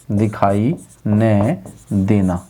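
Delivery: very slow and low, word by word; slow dictation. Shown as a demonstration. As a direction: pause after दिखाई and after न, stretch each. Teacher repeats answer for dictation.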